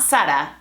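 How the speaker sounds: Sara with z said as s, SAH-ra; Latin American Zara. Shown as a demonstration. Teacher showing the Latin American way to say Zara. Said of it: "We call it Sara".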